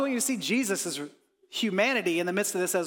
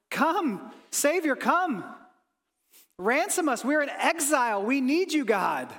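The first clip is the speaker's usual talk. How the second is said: higher, longing plea; yearning cry; 6 semitones higher than usual. Acted. Worshippers in exile crying out for the Savior, yearning plea. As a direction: reach up to God in longing.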